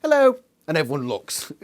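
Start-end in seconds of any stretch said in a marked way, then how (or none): 0.0-0.4 higher, louder sing-song hello; a breezy hello; mock casual hello. Axe casually getting everyone's attention, higher sing-song. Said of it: "Axe can just go".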